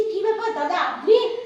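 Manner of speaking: high, loud surprised question; startled. Acted. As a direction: jump at the late knock. Startled at midnight visitor.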